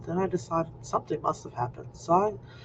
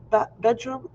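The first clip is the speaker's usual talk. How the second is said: normal talking voice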